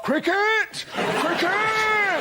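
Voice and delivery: high, drawn-out hurrahing cry; Telegraph. Retold or imitated. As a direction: cry out the sport's name like a proud toff paper. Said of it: "Tell 'em, Telegraph".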